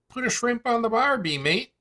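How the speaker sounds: quick, high-pitched mock Australian; Australian accent. Shown as a demonstration. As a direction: put on a broad Australian accent. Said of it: "What accent?".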